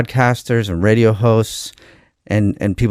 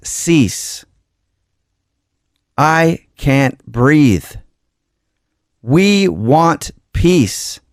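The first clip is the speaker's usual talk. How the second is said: slow, raised, pleading poem reading; poem recital; 6 semitones higher, 4 dB louder, slower than usual. Acted. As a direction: lay each line down slowly like a prayer for justice. Reads his George Floyd poem.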